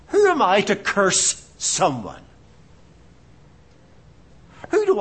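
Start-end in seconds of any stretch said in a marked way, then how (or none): none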